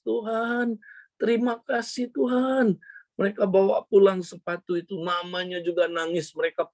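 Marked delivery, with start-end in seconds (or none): 0.1-2.8 retold weepy relief, pitched high; tearful thanks. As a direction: imitate an overwhelmed cry of thanks to God. Retelling boy's tearful thanks.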